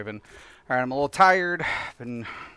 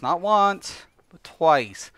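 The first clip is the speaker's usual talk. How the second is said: Not once, but TWICE; stress on twice; 5 semitones higher, 6 dB louder, much slower than usual. Real, not acted. Streamer hammers home how many times she died. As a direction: lean hard on twice.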